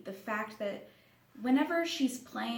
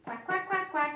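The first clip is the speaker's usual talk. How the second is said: put-on higher duck sounds for a toddler; duck quacking; 9 semitones higher, 3 dB louder than usual. Imitates a duck.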